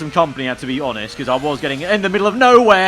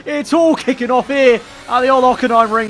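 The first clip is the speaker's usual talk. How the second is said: race commentator; 8 semitones higher, 6 dB louder than usual. Acted.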